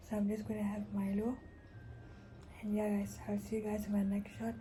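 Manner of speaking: hushed morning whisper. Real, not acted. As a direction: keep it to a whisper.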